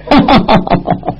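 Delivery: short breathy scornful laugh; knowing laugh. Acted. Bao Gong laughing at Shen Guqing's excuse, scornful. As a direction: laugh him off before speaking. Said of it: "包公笑笑说".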